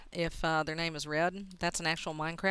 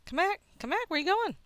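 high, loud taunting call; calling after someone; 11 semitones higher, 7 dB louder than usual. Real, not acted. Player chases a fleeing opponent, calling out high.

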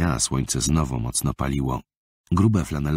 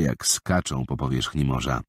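plain reading voice

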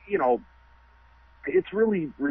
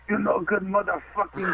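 hammed-up Irish brogue; Irish accent; 5 semitones higher than usual. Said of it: "This is the Irish shit".

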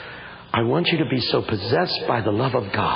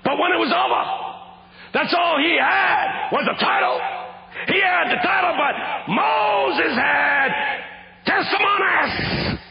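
preaching climax; slower than usual. Real, not acted. Preacher's refrain on titles, climactic shouted preaching. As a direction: stretch out the last word in triumph.

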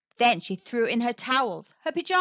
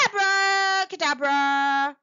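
slow, drawn-out magic incantation, pitched up; shouted spell; 5 semitones higher, 4 dB louder, slower than usual. A narrator's reading. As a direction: cast the spell loudly, stretching the syllables. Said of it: "picked up the wand and shouted".

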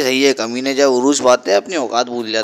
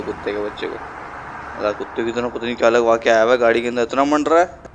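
ordinary delivery

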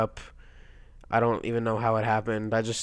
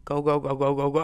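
rapid chanted "go"; slightly raised, repeated cheer; urging on; 4 semitones higher than usual. Real, not acted. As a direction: egg the runner on. Urging hamster on.

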